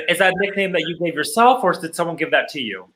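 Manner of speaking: normal talking voice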